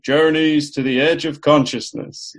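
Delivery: big booming radio announcer voice. Retold or imitated. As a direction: boom the title out like an old radio announcer. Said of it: "I pictured a big booming announcer saying".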